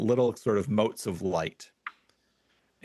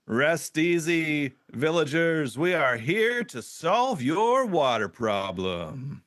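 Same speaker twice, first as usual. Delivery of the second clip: slow, lofty elf leader, higher; a noble elf leader announcing his grand arrival, taking his time; slow, stately speech to the villagers; 6 semitones higher, slower than usual. Acted.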